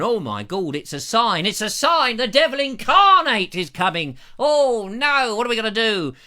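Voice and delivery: a touch of comic terror; mock panic. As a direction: play the panicking villagers. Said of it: "people were going".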